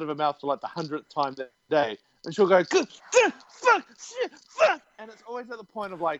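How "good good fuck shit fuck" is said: very high, squeaky bursts; imitated screaming. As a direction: mimic her outburst in short high-pitched yelps. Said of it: "I'm screaming my head off".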